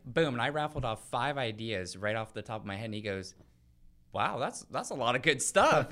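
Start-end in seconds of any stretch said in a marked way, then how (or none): 4.1-5.9 put-on light country accent, pitched higher and louder. Retelling a caller's praise, a light country accent. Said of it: "he has kind of a country accent".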